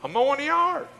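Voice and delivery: very high-pitched husband's retort; husband answering back. Retold or imitated. Ed answers his wife from the yard, high-pitched retort.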